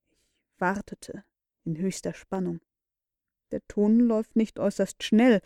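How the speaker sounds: ordinary delivery, nothing marked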